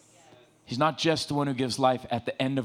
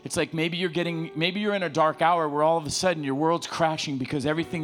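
usual speaking voice

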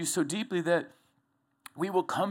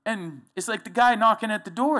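usual speaking voice

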